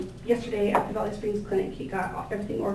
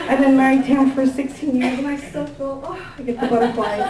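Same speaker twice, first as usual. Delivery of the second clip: talking through a real laugh, a bit louder; choked-up laughing speech; laughing, emotional; 3 semitones higher, 4 dB louder than usual. Real, not acted. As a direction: giggle through the words, overcome. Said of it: "I just get emotional".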